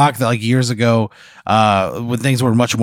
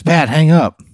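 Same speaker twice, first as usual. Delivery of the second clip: higher, louder panicked demand; mock frantic plea; 7 semitones higher, 5 dB louder than usual. Frantic driver demanding Pat hang up, louder mock panic. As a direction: beg urgently, hammed-up panic.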